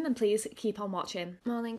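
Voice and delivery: ordinary delivery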